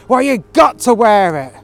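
loud, high, scornful shout; disgusted shout. Retold or imitated. Manager shouting at the worker with disgust. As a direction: bark it at him in disgust. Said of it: "disgustingly shouted".

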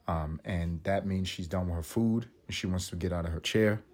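ordinary delivery

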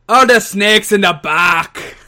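put-on slow, loud Irish accent with an odd timbre; slow Irish brogue, collar popped; Finn Balor. Asked by the co-host to do Finn Balor, slow Irish accent. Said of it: "now do your Finn Balor".

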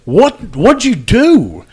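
high, loud, slow shocked question. Retold or imitated. Officer recalling his shock at the injured cyclist, high loud disbelief. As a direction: blurt it out in disbelief, drawn out.

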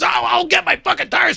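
high, loud mock yell; retold angry comeback. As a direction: imitate someone shouting back in a fight. Retold shouting match.

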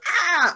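a sudden high squawk like a bird; bird screech. Retold or imitated. Retelling a scary film scene to a friend, a high bird screech. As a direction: shriek it out like a startled bird. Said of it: "a bird kind of goes".

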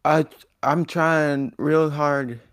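slow, loud, high pleading. Acted. Pleading in mock fight.

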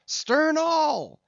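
loud, very high command; drawn-out high shout across the boat; shouted order. A narrator's reading. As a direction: bellow the order high to the oarsmen.

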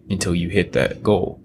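usual speaking voice

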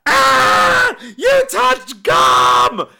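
high, loud shriek, stretched out slowly; a mock shriek of disgust; shrieking friend. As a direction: shriek it like you're horrified. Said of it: "she just goes".

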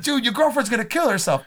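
high-pitched comic warning; mock alarmed plea. Fan warning the character about his girlfriend, high mock alarm. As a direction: plead it way up high.

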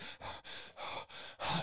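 acted hyperventilating gasps; faked panic, puffing air; panic-attack breathing. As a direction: imitate short panicky breaths, voiceless. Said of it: "like, panic attack".

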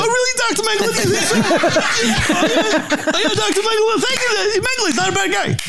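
very high, laughing, hammed-up Larry; high mock Larry voice. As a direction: squeal it through laughter, flattered. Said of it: "He's like".

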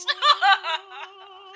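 villain laugh. Acted. Villain drops act, gloats.

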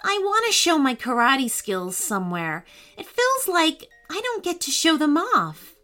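a touch of sadness, understated; a little girl gently let down; mildly sad. Acted. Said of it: "replied Elsie sadly".

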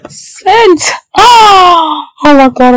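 high, loud cry of relief; tearful joyful shout. Real, not acted. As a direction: burst out in tearful joy. Finally sending exam email.

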